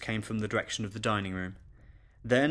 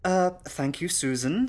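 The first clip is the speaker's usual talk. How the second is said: higher, flustered lady of the house; nervous; 7 semitones higher, 4 dB louder, slower than usual. Acted. Mistress thanks governess after the fight, mildly nervous. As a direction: hesitate into a jittery thanks. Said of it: "now a trembling bouquet of nerves".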